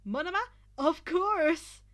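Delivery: high, loud shout; eager shout. A narrator's reading. Monoma answering Izuku with a loud shout. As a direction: yell it out.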